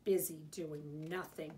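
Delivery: low grumble under the breath; grumbling. A narrator's reading. Big Mouse about idle Little Mouse, low grumble. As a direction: grumble it low, mildly put out. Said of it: "grumbled Big Mouse".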